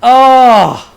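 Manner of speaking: loud, high burst of delight; excited gasp. Real, not acted. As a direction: cry out in joy. Still reacting to the victory.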